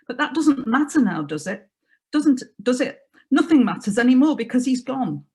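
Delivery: a touch of panic, lines spilling over each other; garbling in grief. Acted. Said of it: "she started to garble".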